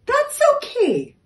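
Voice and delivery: very high, bright reassurance; kind window. A narrator's reading. Window forgiving the door's slip, high and kind. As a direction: brush it off gently. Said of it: "said the window as she shone".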